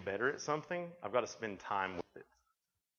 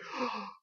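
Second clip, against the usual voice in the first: mock cold gasp; 10 semitones higher than usual. Son gasps in cold water.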